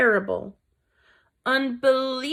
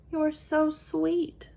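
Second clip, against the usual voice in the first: high, slow, soft princess voice; a sweet princess cooing; 7 semitones higher, 4 dB quieter, much slower than usual. Acted. Princess cooing softly to tiny Despereaux.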